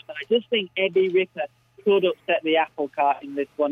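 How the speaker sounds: ordinary talk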